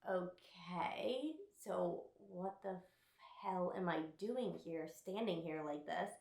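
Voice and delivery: hushed, retold disbelief; baffled undertone. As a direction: question it softly, as if under your breath. Retelling own baffled reply.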